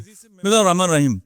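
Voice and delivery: "بسم اللہ الرحمن الرحیم" gabbled fast in one careless run; rushed recitation. Shown as a demonstration. Preacher showing how people rush through Bismillah, said too fast. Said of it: "بہت تیزی سے میں بول دیتے ہیں".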